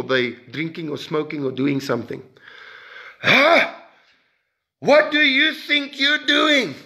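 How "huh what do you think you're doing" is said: high, slow, shocked scolding. Retold or imitated. Preacher voicing a judgmental churchgoer, high and shocked. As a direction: catch a sinner red-handed, aghast.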